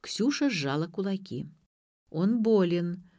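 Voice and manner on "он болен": usual speaking voice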